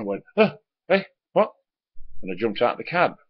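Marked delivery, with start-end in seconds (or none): 0.3-1.5 sudden high startled cry, just woken; startled jolt. Retold or imitated. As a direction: jerk awake in alarm at the noise. Woken by a noise on windscreen.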